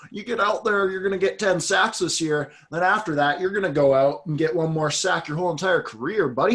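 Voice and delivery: hammed-up impression of a veteran coach telling a player his future; Bill Belichick accent. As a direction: lay it out to a player like a blunt coach. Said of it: "I don't know why I use that accent".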